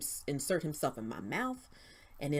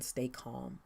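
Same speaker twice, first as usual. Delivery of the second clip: a soft, much quieter "Stay calm" spoken low; hushed inner voice; 3 semitones lower, 9 dB quieter than usual. Retold or imitated. Recalling the voice she believes was the Lord, hushed and soft. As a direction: pass on the quiet command gently, as if from inside. The Lord's voice, retold.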